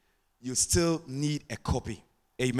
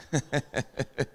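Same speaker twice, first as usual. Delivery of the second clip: chuckle; 3 dB quieter than usual. Real, not acted. Preacher joking about changing wives, chuckling. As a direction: laugh in short, even pulses. Laughs at own joke on wives.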